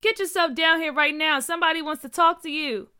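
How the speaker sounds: high, loud call upstairs; screaming. A narrator's reading. Mom screaming for Junior to come down. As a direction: yell up at her son. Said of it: "Junior's mom screamed".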